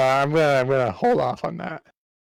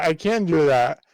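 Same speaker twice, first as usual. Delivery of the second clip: ordinary talk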